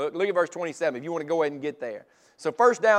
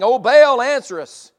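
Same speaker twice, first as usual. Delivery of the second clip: mock cry to Baal; 7 semitones higher, 11 dB louder than usual.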